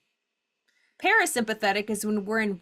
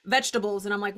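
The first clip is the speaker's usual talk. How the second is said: normal talking voice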